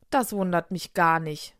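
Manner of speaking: plain reading voice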